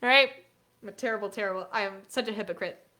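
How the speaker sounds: ordinary delivery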